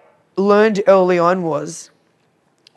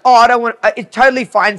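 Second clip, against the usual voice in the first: higher, louder flustered reassurance; awkward brush-off; 5 semitones higher, 8 dB louder, faster than usual. Retold or imitated. Retelling a client avoiding saying why she was unhappy, awkward. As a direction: wave it away in a raised voice.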